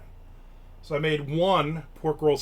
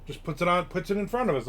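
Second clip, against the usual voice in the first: ordinary talk, nothing marked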